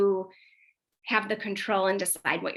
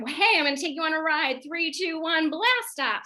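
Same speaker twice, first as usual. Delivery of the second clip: playful blast off; 7 semitones higher than usual. Shown as a demonstration. To her son, a pretend rocket ride, lightly excited. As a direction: turn it into a game with a big countdown.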